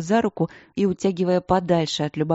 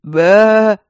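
loud rattly goat bleat; 4 semitones higher, 12 dB louder than usual. A narrator's reading. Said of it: "проблеял дребезжащим голосом Ипполит".

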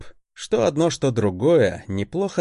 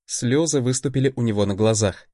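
usual speaking voice

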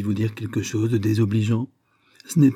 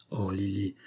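soft plea; 2 semitones lower, 9 dB quieter than usual. A narrator's reading. Gentle plea to Lily.

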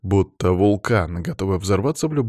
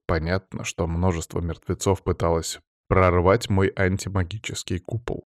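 usual speaking voice

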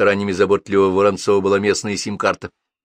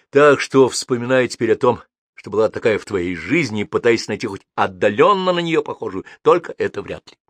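ordinary delivery

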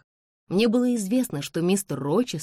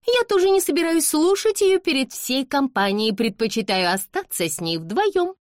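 high, raised, haughty voice; imperious young lady; 10 semitones higher, 4 dB louder than usual. A narrator's reading. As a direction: declare it with no room for argument. Same haughty lady overrules mother. (надменно, высоким голосом)

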